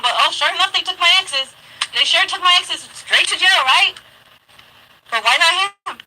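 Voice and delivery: rising high sarcastic complaint; sarcastic inner rant. Retold or imitated. Ranting in her head about the police, rising sarcasm. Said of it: "I said in my head".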